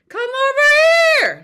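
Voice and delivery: high, loud call of Come over here. Shown as a demonstration. Teacher demonstrating a call with held breath. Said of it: "continue that breath holding".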